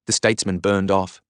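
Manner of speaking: plain reading voice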